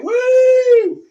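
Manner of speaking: a high, loud whoop of excitement; wild whoop. Retold or imitated. Dodging cars, whooping.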